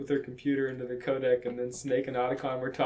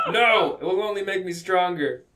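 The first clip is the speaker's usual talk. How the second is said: louder, higher acted refusal; defiant retort; 5 semitones higher, 5 dB louder than usual. Villain rejecting a warning, defiant. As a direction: throw out the No with bold defiance.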